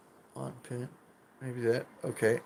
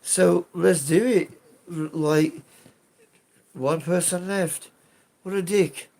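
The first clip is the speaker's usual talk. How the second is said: put-on British accent; 5 semitones higher, 5 dB louder than usual. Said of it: "everyone likes a man with an accent".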